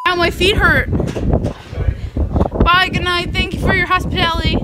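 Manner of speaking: rowdy party animal. Real, not acted. Drunk loud night out, shouting.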